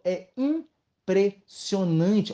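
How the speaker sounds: IMPRESSIONANTE very slow, broken into syllables; drawn-out amazement.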